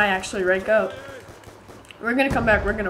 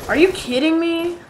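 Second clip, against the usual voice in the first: higher pitched incredulous cry; outraged disbelief; 7 semitones higher, 4 dB louder than usual. Real, not acted. Gamer reacting to an unfair death, incredulous cry. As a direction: exclaim in disbelief.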